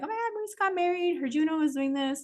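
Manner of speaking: high-pitched "oh my God", then easing down; mock excited gossip. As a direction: play an excited reaction to celebrity news. Imitates own excited video reaction.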